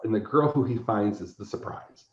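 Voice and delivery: usual speaking voice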